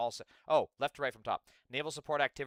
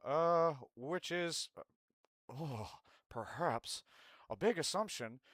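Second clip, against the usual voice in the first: Hank Hill impression; 5 semitones higher, 4 dB louder than usual. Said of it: "tried to do this in Hank Hill's voice".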